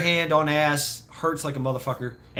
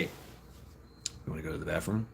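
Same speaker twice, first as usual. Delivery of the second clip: very low, soft, ominous question; quiet low menace, imitating mom; mother's hushed threat; 10 semitones lower, 15 dB quieter than usual. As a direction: drop right down, nearly under the breath. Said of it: "she would say".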